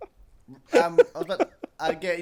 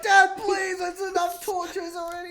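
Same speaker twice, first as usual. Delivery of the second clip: whiny yell; 8 semitones higher than usual. Retold or imitated. Retelling yelling at dad.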